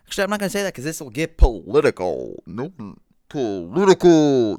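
dramatic announcer. Acted. Dramatic refusal to get political.

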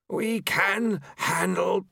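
high, slow character voice; objecting Famine. A narrator's reading. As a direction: begin a drawn-out objection, pitched high.

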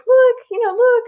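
high, loud, thrilled "look"; excited child's exclamation. Retold or imitated. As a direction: imitate a kid thrilled by a discovery. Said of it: "it was really exciting".

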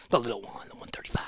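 hushed whispered aside; whispered side note. Real, not acted. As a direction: drop to a whisper as if sharing a secret. Hushed aside about the fighter.

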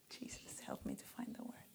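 under-the-breath plea for help; whispered prayer. Real, not acted. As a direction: murmur it to Jesus alone. Private prayer mid-sermon.

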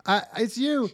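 high, loud mock gush; blondie gushing. Mock blondie spots her friend, high and loud. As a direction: squeal with delight. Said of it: "And the blondie says".

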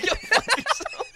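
high, slow go fuck yourself breaking into laughter; laughing retort. Real, not acted. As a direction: laugh it out in mock outrage. Friend calls him racist, jokingly.

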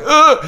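frustrated ugh. Retold or imitated. Retelling panic at hill starts.

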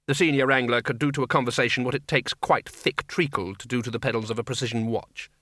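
usual speaking voice

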